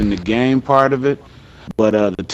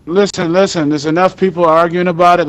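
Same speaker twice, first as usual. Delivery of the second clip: raised, high voice over the room; calling for order; 5 semitones higher, 3 dB louder than usual. Real, not acted. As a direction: cut through the argument. Calling a noisy group to order.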